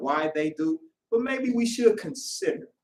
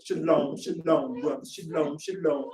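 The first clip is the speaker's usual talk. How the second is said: low, laid-back, understated greetings; cool greeting; 7 semitones lower, 5 dB quieter than usual. Acted. Brothers greeting each other at the gathering, cool and low-key. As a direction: play it cool, keep it low and casual. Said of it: "we try to hold that cool shalom".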